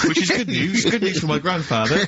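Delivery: talking through laughter; laughing. Real, not acted. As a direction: chuckle the words out. Laughing at own joke.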